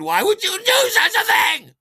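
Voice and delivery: very high, loud outraged scream; hammed-up hysterical screech; shriek. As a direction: shriek the accusation at full force. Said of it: "I immediately shrieked at him".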